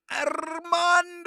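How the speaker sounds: huge high drawn-out holler of a name; loud, high, long-held shout of welcome; shouted greeting. Real, not acted. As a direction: bellow the name like a hype announcer, stretched long. Hailing a viewer who joined the stream.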